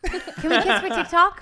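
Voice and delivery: high, loud and laughing, slowed. Real, not acted. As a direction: giggle the question out. Laughing while asking to kiss.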